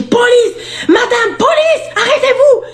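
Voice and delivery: shouting police. Retold or imitated. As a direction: call out like a cop.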